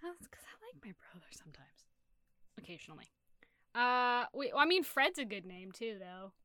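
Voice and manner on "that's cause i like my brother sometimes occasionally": very soft, near-whispered admission; hushed aside. Real, not acted. Admitting to the others they like their brother, hushed aside.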